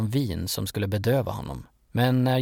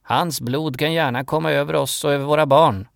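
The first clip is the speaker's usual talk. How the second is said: raised, higher crowd voice; narrated crowd shout, louder and higher; shouting back; 4 semitones higher, 5 dB louder than usual. A narrator's reading. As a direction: call it back loudly as the mob. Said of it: "folkmassan skrek tillbaka".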